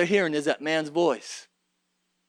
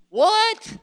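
high, rising disbelieving "what"; astonished; 3 dB louder than usual. Acted. Preacher reacts to Jesus's startling answer, astonished. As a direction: react as if you can't believe it.

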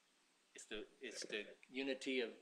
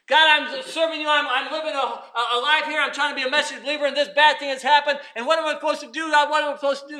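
pleading complaint; 12 semitones higher, 19 dB louder than usual. Acted. Moses complaining to God.

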